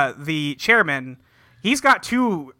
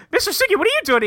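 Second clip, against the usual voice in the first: much higher, lightly voiced kid asking; raised-pitch mock student voice; 14 semitones higher, much faster than usual. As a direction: squeak it up like a startled student. Students voiced in recapped scene.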